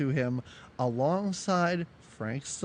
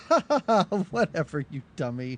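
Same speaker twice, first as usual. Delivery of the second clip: dismissive mock laugh. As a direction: fake ha-ha falling into a brush-off. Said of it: "that's kind of her response to him".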